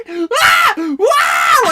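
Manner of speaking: high loud screaming wail; mock panic scream. Stepmom freaking out on the freeway, mock screaming. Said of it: "She's like".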